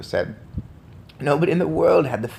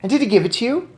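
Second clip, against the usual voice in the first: eager question; 7 semitones higher, 4 dB louder, faster than usual. A narrator's reading.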